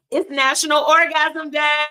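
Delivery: hyped holiday announcement; excited announcement. Real, not acted. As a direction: shout the holiday like a party.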